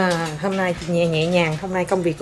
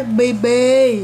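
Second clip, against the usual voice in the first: demo; 3 dB louder than usual. Shown as a demonstration. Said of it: "bà cố bà nói sao".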